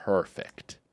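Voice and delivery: hushed, drawn-out mock admiration; hushed approval. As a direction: breathe it very softly, savouring the word. Marketer retold admiring her, hushed.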